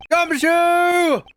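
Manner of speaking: high shout of the name. Acted. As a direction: yell his name in alarm. Calling out for missing detective.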